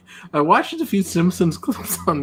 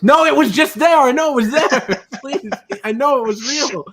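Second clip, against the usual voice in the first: insistent protest; 7 semitones higher than usual. Retold or imitated. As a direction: protest as if nobody believes you. Retold insistence about a vanished sight.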